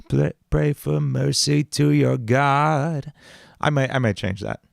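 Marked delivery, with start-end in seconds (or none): 0.1-3.1 demon voice. Acted. Demonic voice line.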